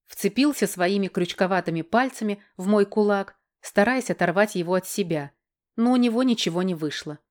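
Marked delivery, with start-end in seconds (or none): none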